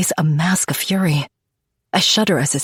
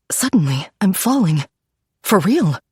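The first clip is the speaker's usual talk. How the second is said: ordinary delivery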